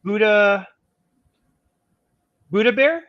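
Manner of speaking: normal talking voice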